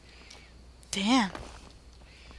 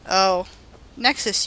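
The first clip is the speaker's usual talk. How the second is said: ordinary talk, nothing marked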